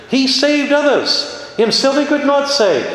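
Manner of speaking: scorn him as he hangs there; sneering mockery. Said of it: "said the scribes and Pharisees".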